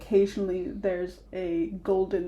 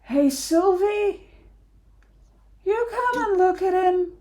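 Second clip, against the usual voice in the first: shout over the shoulder; 13 semitones higher, 7 dB louder than usual. Acted. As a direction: holler for someone across the way. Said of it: "shouts over his shoulder".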